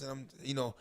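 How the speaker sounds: ordinary talk, nothing marked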